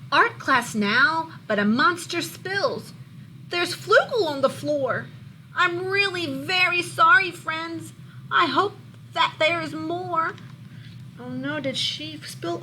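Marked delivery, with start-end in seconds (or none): 0.1-10.4 lively, louder storybook read-aloud; reading to children. A narrator's reading. Teacher reading a monster book to her class, lively storybook voice. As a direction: bring the picture book alive for the class.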